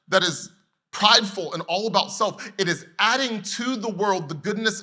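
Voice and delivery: loud, high preaching cadence; fiery preaching. Acted. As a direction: preach it out with full force. Preaching at full force.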